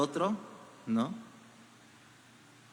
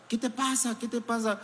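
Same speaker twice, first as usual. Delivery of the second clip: higher, concerned parent voice asking; a parent asking a crying child what's wrong, higher and concerned; worried parent; 6 semitones higher than usual. Acted. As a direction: ask like a worried mom.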